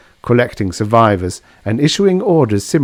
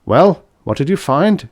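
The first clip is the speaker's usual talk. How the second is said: anxious; 7 semitones higher, 4 dB louder than usual. Acted. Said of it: "anxiety in his voice".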